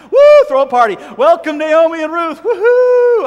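loud, high whooping welcome; party cheer. Pretend. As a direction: cheer like the town throwing a party. Town celebrating Naomi's arrival.

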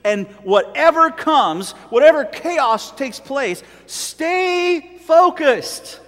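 fervent sermon climax; full-force preaching. Acted. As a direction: drive it home from the pulpit, stretching stay focused. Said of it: "I'm preaching to myself this morning".

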